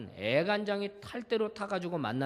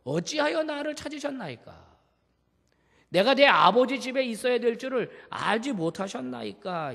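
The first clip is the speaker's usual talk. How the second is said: higher, pointed question back; cheeky reply; 6 semitones higher, 5 dB louder than usual. Retold or imitated. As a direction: answer back a bit brashly. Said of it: "약간 건방져 보이지 않습니까?". (약간 건방진 대답 말투)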